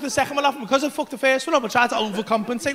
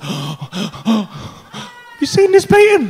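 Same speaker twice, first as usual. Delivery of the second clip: awestruck; 8 dB louder, much slower than usual. Retold or imitated. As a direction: ask in wonder. Awestruck at painting.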